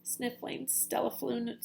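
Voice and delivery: ordinary delivery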